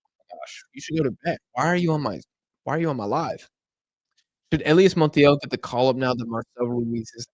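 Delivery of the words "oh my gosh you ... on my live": ordinary talk, nothing marked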